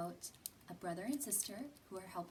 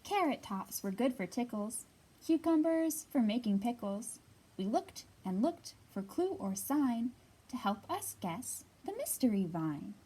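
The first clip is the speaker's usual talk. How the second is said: louder, lively storybook read-aloud; reading to children; 2 semitones higher, 9 dB louder than usual. Acted. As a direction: read it out big and bright for a circle of kids. Reads picture book aloud.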